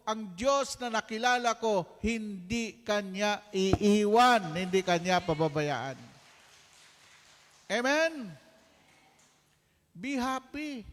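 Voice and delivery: high, loud, impassioned pulpit delivery; fiery sermon climax, pounding each line; preaching at full force. Acted. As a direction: drive it home to the congregation.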